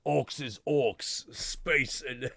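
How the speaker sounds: put-on slow, dropped-r orc accent; outback Aussie orc. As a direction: put on an uneducated Australian orc. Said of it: "they all sound like really super".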